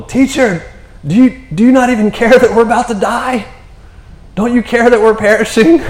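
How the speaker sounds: high, loud mock panic; panicked disciple. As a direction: whine in hammed-up terror at the teacher. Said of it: "were so freaked out about".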